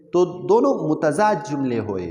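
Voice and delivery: ordinary talk, nothing marked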